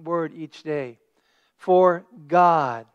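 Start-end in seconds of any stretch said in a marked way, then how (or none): 1.6-2.9 God hit loud and firm; stress on God. Shown as a demonstration. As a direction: lean on the word God. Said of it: "emphasize God".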